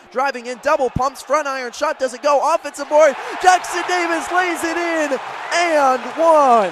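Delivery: big excited basketball call. Real, not acted. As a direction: sell the and-one to the radio crowd. Basket scored on a rebound.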